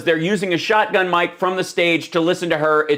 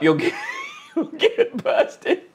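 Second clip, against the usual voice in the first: laughing hard. Real, not acted. Streamer explaining the fart sneak, breaking up laughing. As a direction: pitch jumping up with laughter. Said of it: "laughing so hard I'm crying".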